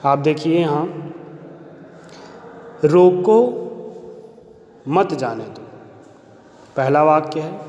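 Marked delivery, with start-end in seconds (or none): 2.8-5.6 रोको lifted and set apart, then a pause before मत जाने दो; pause after रोको. Shown as a demonstration. Teacher showing pupils how a comma changes meaning, pause after रोको. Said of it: "अल्प विराम के लग जाने से".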